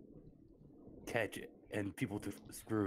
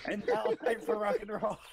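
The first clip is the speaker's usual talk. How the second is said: much higher, louder rock-show shout; rock announcer yell; 12 semitones higher, 5 dB louder than usual. Pretend. Player joking about bursting in, shouting like an announcer. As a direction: hype it like a rock concert.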